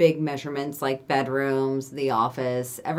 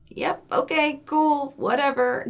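high, slow, put-on whatever; mock dismissive; 10 semitones higher, slower than usual. Telling of brushing off Adam, high and dismissive. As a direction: sing out a checked-out yep okay, higher and slow. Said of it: "And I'm just like".

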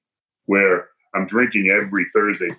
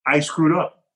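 ordinary talk, nothing marked

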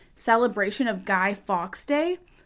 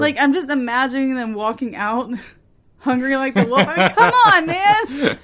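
laughing; 5 dB louder than usual. Real, not acted. Laughing at darts walkouts.

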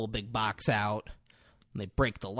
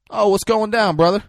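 high, loud, breezy greeting; a friendly greeting to Roger, high and loud and easygoing; super casual; 10 semitones higher, 11 dB louder than usual. Retold or imitated. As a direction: greet an old friend like nothing is wrong. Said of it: "super casual".

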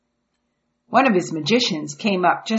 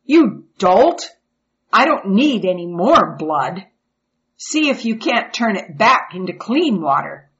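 furious royal scolding; angry king; 5 dB louder than usual. Acted. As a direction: scold the magician loudly. Pharaoh scolds magician.

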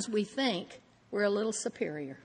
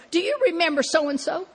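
raised, louder impatient question to husband; quizzing him, voice up and pushing; impatient quiz; 7 semitones higher, 7 dB louder than usual. Retold or imitated. Quizzing a forgetful husband, raised and impatient. Quoting herself asking husband.

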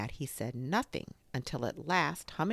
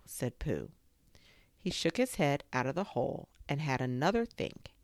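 usual speaking voice

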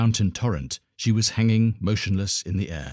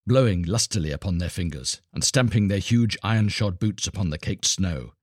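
ordinary delivery

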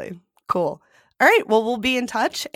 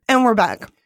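usual speaking voice

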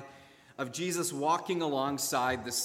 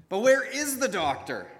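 louder, higher demanding question; 7 semitones higher, 6 dB louder than usual. Acted. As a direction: demand an answer. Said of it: "she demanded to know".

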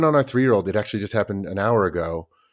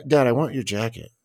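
lightly voiced calm child; 3 semitones higher than usual.

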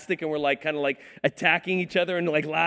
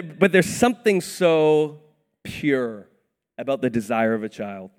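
normal talking voice

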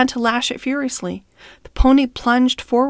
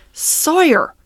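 higher, stern call of the name; stern cry; 7 semitones higher, 4 dB louder than usual. A narrator's reading. Master hails the man beating the pony, stern cry. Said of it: "he cried in a stern voice".

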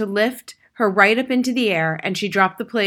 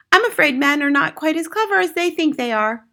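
mother; 7 semitones higher, 5 dB louder than usual. A narrator's reading. Mother confides a lesson to Matilda.

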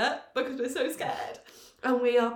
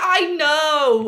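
higher, much louder protest; exasperated cry; 6 semitones higher, 11 dB louder than usual. Retold or imitated. Being lectured by friends, loud exasperated protest. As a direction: throw it out, fed up. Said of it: "I was like".

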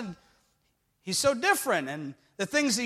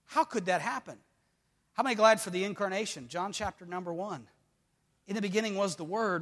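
low, soft reading of scripture; 3 semitones lower, 6 dB quieter than usual. Acted. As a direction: read the verses quietly and steadily. Preacher reading scripture aloud.

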